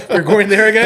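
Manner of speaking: loud high laughter into the words; real belly laugh; laughing hard. Real, not acted. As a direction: laugh through the question. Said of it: "Thought we didn't learn the first time".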